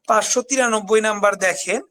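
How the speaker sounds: ordinary talk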